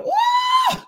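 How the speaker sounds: high shouted woo; praise whoop. Real, not acted. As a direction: let out a joyful whoop. Preacher whooping.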